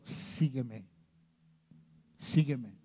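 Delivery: suave y lenta, como Jesús llamando; ofrécelo con ternura, casi en voz baja; invitación tierna. Acted. (Jesús invitando a Pedro con voz suave y tierna)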